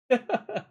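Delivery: short high burst of laughter; sharp laugh. Real, not acted. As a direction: laugh out at the chat. Laughs at chat reply.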